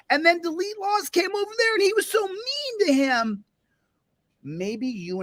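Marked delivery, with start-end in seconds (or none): 0.1-3.4 high whiny mockery; mocking whine. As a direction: whine it like a tattletale. Mocking complainers about him.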